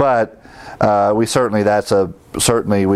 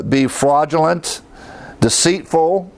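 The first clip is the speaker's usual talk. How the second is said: ordinary talk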